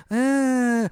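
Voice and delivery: imitating a kid's tiny, high, delicate whimper; soft little cry. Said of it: "the softest, most delicate cry".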